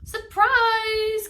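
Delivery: high, loud joyful shout; shouted surprise. Acted. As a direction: friends jump out and yell.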